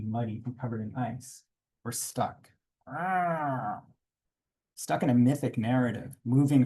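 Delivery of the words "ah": pained cry. Real, not acted. Car stuck on icy road.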